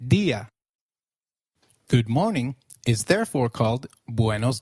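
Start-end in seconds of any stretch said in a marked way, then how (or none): 0.1-0.5 "Dia" stressed on the I, DI-a; stress on accented I. Shown as a demonstration. Teacher has learner try "Dia" again, stressing the I. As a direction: lean on the first syllable of "Dia". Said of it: "then that syllable receives the stress".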